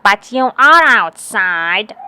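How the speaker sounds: loud slow booming doorknob. A narrator's reading. Doorknob telling Alice she is outside. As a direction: boom it out slowly and plainly.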